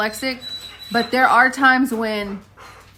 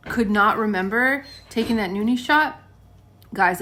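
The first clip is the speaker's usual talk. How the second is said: ordinary talk, nothing marked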